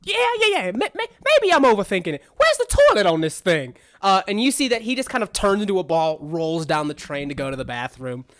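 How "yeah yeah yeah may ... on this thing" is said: high, loud, hurried character voice; flustered game character. Acted. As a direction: fluster through it in a raised voice.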